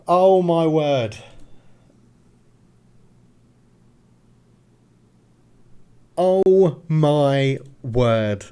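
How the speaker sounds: slow awe. Real, not acted. Trophy won, stunned.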